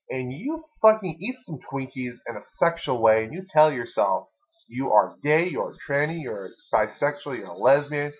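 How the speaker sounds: usual speaking voice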